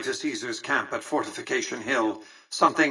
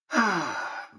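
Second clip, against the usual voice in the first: audible breathy sigh; falling sigh; 6 semitones higher, 3 dB quieter than usual. Acted. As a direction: let out a short sigh. Exasperated remark in game dialogue.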